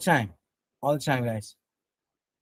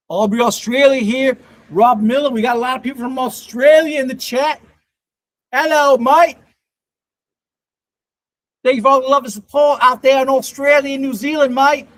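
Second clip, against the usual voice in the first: put-on loud, high-pitched Australian accent; 6 semitones higher, 4 dB louder than usual. Streamer hailing Australian viewers in the chat, put-on Australian accent. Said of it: "practice my Australian accent".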